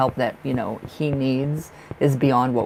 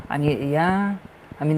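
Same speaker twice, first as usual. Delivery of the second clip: ordinary talk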